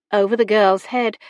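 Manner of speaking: ordinary delivery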